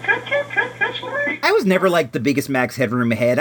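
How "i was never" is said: laughing. Real, not acted. Laughing after Max Headroom clip.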